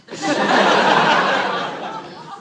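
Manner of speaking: high, long evil cackle; evil laugh. Acted. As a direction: devilish cackle after the line. Devilish laugh.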